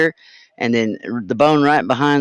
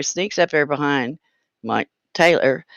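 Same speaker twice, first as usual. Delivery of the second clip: normal talking voice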